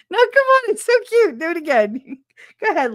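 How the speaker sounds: very high, loud, laughing plea; wheedling son. Retold or imitated. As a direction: beg gleefully in a squealy voice. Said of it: "He's like".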